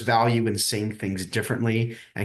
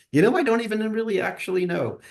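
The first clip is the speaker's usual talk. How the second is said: sheepish admission; 11 semitones higher than usual. Retold or imitated. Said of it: "And I'm like".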